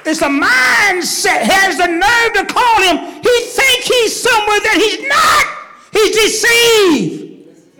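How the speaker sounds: loud, high shouting sermon with a laugh breaking in; full-force preaching. Acted. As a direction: thunder it at the congregation.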